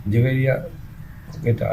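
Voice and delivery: normal talking voice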